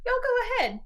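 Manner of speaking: high, loud send-off; calling out. Acted. Calling to companions to leave.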